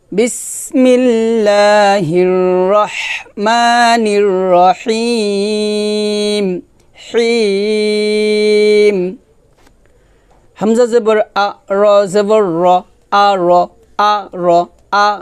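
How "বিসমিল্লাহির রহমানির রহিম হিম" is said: slow, melodic Quranic chant; tajweed-style slow recitation. Shown as a demonstration. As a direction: recite slowly, drawing out each vowel. Recited bismillah again.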